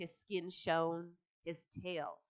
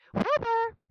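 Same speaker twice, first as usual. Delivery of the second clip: loud, high call; 12 dB louder than usual. A narrator's reading. Charlotte waking Wilbur, loud high call. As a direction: call across the barn. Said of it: "she called".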